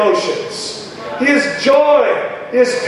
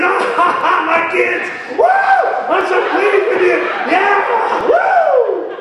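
high, excited laughing cheer; high whooping praise; joyful father cheering; 9 semitones higher than usual. Acted. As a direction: cheer over your kids like a delighted dad. God voiced rejoicing over children.

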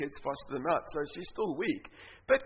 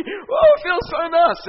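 much higher, louder, slow delighted shout; delighted cry; 12 semitones higher, 13 dB louder, slower than usual. Retold or imitated. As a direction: cry out in relief as it feels good. Woman in relief.

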